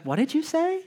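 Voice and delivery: high, baffled "what did you say"; incredulous husband. Retold or imitated. Nick asking his wife in disbelief, high-pitched.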